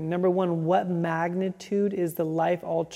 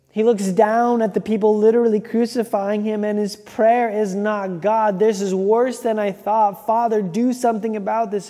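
preaching at full force; 3 semitones higher, 7 dB louder than usual. Real, not acted. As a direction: drive it home from the pulpit with force. Preacher builds to the climax.

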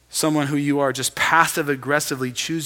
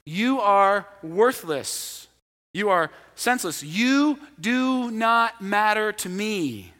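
slow contempt; 3 semitones higher, slower than usual. Shown as a demonstration. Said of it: "It's a word that says".

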